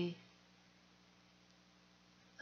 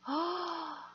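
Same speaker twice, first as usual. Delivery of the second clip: gasp; 9 semitones higher, 6 dB quieter than usual. Real, not acted. Sees stamp.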